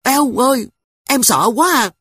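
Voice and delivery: put-on high, loud frightened little girl; a small girl squealing in fright; scared stammer. Sister hiding her face, high frightened girl voice. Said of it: "miệng lắp bắp".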